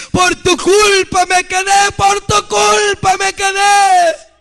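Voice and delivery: high, drawn-out accusing cry; acted-out desperate outcry; anguished accusation. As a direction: hurl the blame in a rising wail. Retold crowd accusing pastor, climax.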